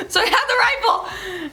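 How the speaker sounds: high, laugh-cracked telling. Real, not acted. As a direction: laugh through the words. Laughing at her own story.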